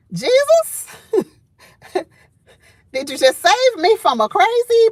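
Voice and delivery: high, astonished cry of relief; stunned exclamation. Retold or imitated. Woman in her truck, shocked at a yelling stranger. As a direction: gasp it out like you just dodged trouble.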